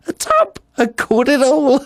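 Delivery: high, gleeful gloat of a madman. Acted. Gloating murderer relishing his cleverness.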